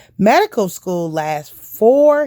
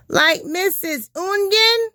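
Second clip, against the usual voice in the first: put-on much higher, eager kids; children's voice; 13 semitones higher than usual. The class asking the doctor about their teacher, much higher child voices. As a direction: sound like a class of curious children.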